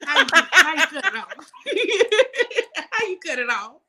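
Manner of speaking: high, laughing mock panic; laughing mock panic over the bill; laughing plea. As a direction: beg for help, laughing. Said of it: "I said".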